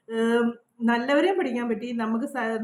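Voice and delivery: normal talking voice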